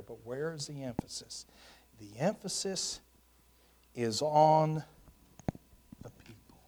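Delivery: a preacher quietly landing his point in a whisper; hushed answer. Acted. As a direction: draw them in and whisper the answer.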